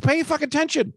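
hammed-up scolding about a quiz; mock exasperated lecture. Husband mock-scolding wife for missing movie, high exaggerated nag. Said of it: "I'm like".

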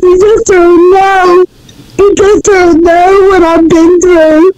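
real crying through heartfelt thanks; tearful. Real, not acted. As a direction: sob out your gratitude. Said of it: "I'm sorry for crying".

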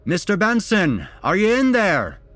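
loud, high-pitched call through a door. A narrator's reading. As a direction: project it to someone indoors. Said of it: "asked Phil with a raised voice".

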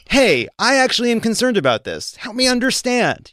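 put-on curious interviewer; mock earnest interviewer. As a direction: play innocent to reel him in. Mock gentle interviewer to McConnell.

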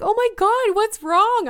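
high, loud, concerned gasp of words; alarmed concern. Retold or imitated. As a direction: imitate a friend rushing over in alarm. Friend rushes to crying speaker.

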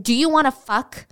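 retold scream. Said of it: "And he screamed".

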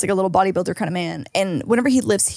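usual speaking voice

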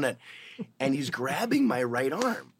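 normal talking voice

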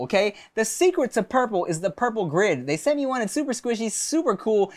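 relaxed, friendly sponsor read. Acted. Host reading a mattress ad to listeners, relaxed. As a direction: sell comfort easygoing.

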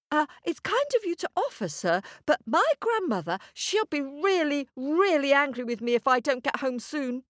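put-on high, fast boy's voice; nervous boy. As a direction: gabble an excuse quickly. Jack voice.

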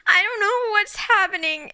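high, wobbly mock-crying plea; patient tearing up at her nurse, high and teary; teary imitation. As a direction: imitate herself breaking into tears. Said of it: "immediately start crying".

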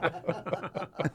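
bright burst of laughter; laughing. Real, not acted. Host laughing at the Bali funeral joke.

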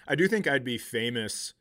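usual speaking voice

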